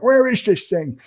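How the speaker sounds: put-on high, squeaky bewildered old-timer; confused old person. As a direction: play a lost grandparent hunting for a button. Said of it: "I'm just that old of a millennial".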